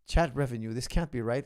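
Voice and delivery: ordinary talk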